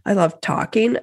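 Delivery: normal talking voice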